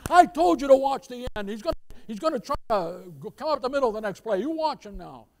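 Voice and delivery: hollered sideline telling-off; scolding father. Retold or imitated. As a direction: bark orders like an angry football dad. Father scolds his son on field.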